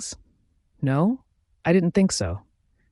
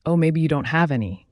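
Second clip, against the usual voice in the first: usual speaking voice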